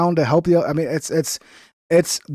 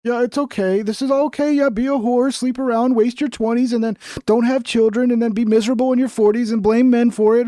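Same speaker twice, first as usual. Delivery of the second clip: mock enabler; 7 semitones higher than usual. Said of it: "being like".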